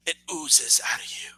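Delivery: soft unvoiced whisper. Real, not acted. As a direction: breathe the jab out in a whisper. Jab aimed at rival.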